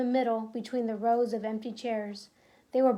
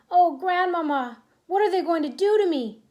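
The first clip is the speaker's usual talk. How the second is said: frightened plea; 5 semitones higher, 5 dB louder than usual. Acted. Trapped boy pleads to grandmother.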